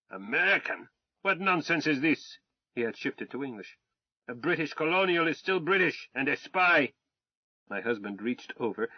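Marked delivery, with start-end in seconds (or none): 0.1-2.4 higher, louder furious officer's tirade; a blustering Tsarist officer shouting insults; arrogant Russian colonel. Acted. Colonel scoffs. 4.3-6.9 higher, louder furious officer's tirade; a blustering Tsarist officer shouting insults; arrogant Russian colonel. Acted. Colonel accuses.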